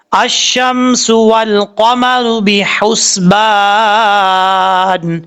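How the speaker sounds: very slow melodic tajweed chant; Quran recitation. Shown as a demonstration. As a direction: chant the whole verse long and even. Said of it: "پوری آیت کو پڑھ لیتے ہیں". (پوری آیت آہستہ خوش الحانی سے)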